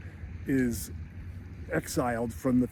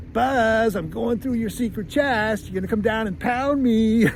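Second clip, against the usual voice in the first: put-on higher, louder taunting kid voice; taunting kid yell; 8 semitones higher, 4 dB louder than usual. Kevin taunting his vanished brother, high yelled taunt. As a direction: jeer up at the ceiling like a cheeky kid. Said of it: "he even yells up into the heavens".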